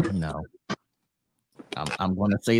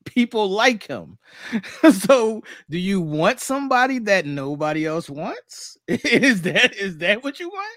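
higher, laughing disbelief; incredulous mocking; 10 semitones higher, 5 dB louder than usual. Real, not acted. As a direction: ask it with amused disbelief. Mocking the ladies' stance, laughing.

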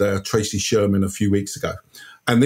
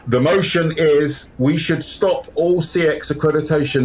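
high, raised formal announcement; announcing the motion; 6 semitones higher than usual. Pretend. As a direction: proclaim the motion to the room.

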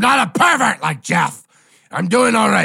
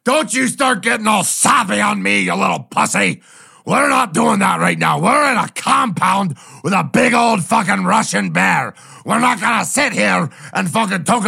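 angry shout; 4 semitones higher than usual. Acted. Drunk friend shouting down a sappy buddy, angry yell. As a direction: shut him up with a furious bark.

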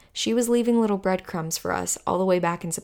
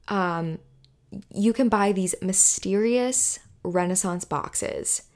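ordinary delivery

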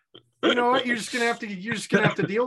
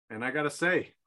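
ordinary talk

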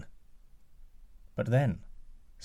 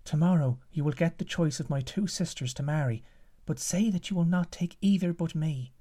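higher, lightly voiced young woman; giant's daughter's voice; 6 semitones higher than usual. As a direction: urge him quickly like a secret ally. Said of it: "and she said to him".

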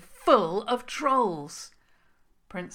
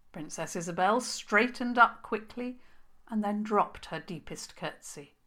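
ordinary delivery

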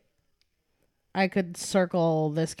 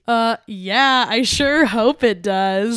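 louder, higher, drawn-out punchline; hammed-up retort; emphatic vine quote; 4 semitones higher, 6 dB louder, much slower than usual. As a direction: stretch it out with mock conviction. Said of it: "one that I literally quote".

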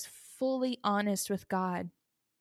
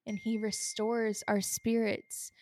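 normal talking voice

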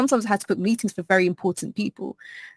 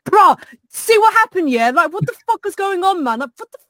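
loud, higher South London rant; Southeast London voice; 6 semitones higher, 4 dB louder than usual. Retold or imitated. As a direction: let the South London come out, exasperated. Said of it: "real Southeast London side of me".

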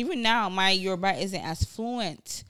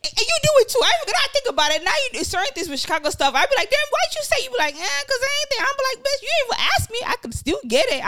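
high and loud, animated retelling; excited; 6 dB louder than usual. Real, not acted. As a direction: pitch way up, rapid and loud.

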